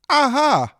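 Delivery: high, loud aha of discovery; eureka aha. Shown as a demonstration. Demonstrating a cookie's eureka, high loud aha. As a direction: show the moment the answer clicks. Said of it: "Like this".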